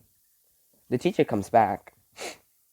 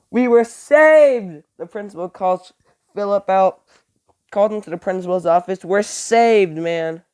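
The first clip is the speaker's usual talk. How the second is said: high, loud burst of relief, stretched out; excited relief; 7 semitones higher, 8 dB louder than usual. Real, not acted. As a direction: shout it like you just escaped death. Relief after the principal arrives.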